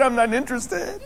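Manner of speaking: high, amused echo of a refusal; laughing requote. Retold or imitated. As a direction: quote it in a high voice through a laugh.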